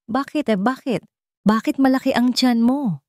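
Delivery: stammered shocked question to the pregnant woman; stunned question. A narrator's reading. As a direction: blurt it out in shock.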